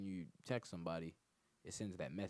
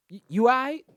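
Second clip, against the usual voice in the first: put-on much higher, loud squeaky girl; mock girl voice; 21 dB louder than usual. The girlfriend asking him timidly in a high squeal.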